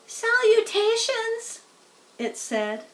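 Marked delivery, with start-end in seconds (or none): 0.0-1.6 high, slow little voice from above; a tiny spider greeting the pig from above. A narrator's reading. Tiny spider calling down to Wilbur, high and slow. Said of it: "he heard a small voice".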